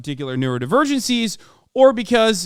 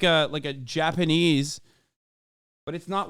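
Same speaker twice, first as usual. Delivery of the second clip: ordinary delivery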